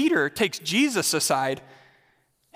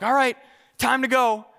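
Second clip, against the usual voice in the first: higher, a bit raised voice; mock mom calling out; 5 semitones higher, 3 dB louder than usual. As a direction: call the kid to leave, like a mom. Mom telling son to leave.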